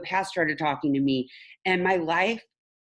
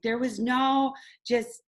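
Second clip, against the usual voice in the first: ordinary talk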